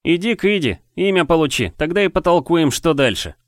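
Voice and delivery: high, loud boss-like orders; puffed-up commanding tone; bossy order. Acted. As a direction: order her about like a big boss. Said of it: "распорядился Ри".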